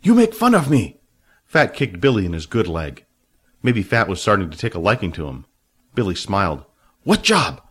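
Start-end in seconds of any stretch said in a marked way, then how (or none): 0.0-1.0 high, loud offended cry; indignant Fat. A narrator's reading. Fat offended by Billy's mimicry, high indignant cry. 7.0-7.6 high, loud quick question; Fat asking. A narrator's reading. Fat pressing Billy about Jack, high voice.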